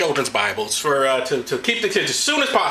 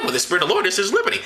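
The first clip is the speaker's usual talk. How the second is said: usual speaking voice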